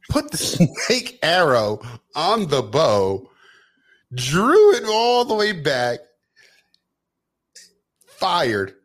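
excited retelling. Retold or imitated. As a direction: hype up the arrow being drawn. Retelling an epic movie scene.

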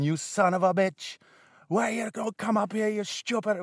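imitating an angry Trinidadian mum; Trinidadian accent. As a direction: rage at the husband below in a Trinidadian accent. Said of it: "That's why I'm doing that accent".

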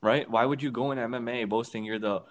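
usual speaking voice

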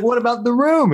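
high, loud, indignant squeal; high indignant woman. Retold or imitated. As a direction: squeak it out in outrage. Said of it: "she's like".

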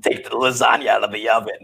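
lightly voiced friend's grandma; hint of a smoky old grandma; smoker grandma. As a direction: imitate a grandma calling about dinner. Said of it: "pictured her to talk like".

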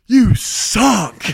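high, loud yelled insult; mock scream. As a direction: yell it at him from the back seat. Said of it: "And then just screams it".

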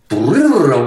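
rolled brrrr noise, quick and buzzy; imitated guitar sweep. Baffled fan mimicking [name]'s sweep picking, rolled trill. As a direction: trill out a fast guitar lick. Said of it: "why did it go".

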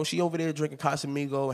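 ordinary delivery

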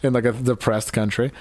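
normal talking voice

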